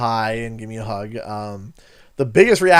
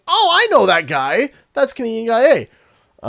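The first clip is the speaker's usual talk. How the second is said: high, louder voice of an excited fan; excited recognition; 9 semitones higher, 5 dB louder than usual. Retold or imitated. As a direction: call out as if spotting someone famous. Overheard fan recognising him.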